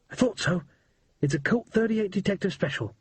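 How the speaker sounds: put-on a scared but excited boy spilling the news; boy Percy, urgent.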